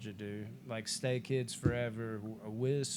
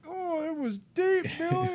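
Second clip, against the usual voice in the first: high, loud, pained whimper of a stabbed friend; wounded Stu; 5 dB louder than usual. Retold or imitated. Stu whining to Billy after the stab, high pained cry. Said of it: "He's like".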